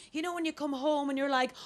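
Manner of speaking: ordinary talk, nothing marked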